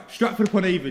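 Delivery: high, very loud announcement; loud exclamation. Real, not acted. Friend bringing up the infamous party, loud exclamation. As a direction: blurt the place name out at full volume.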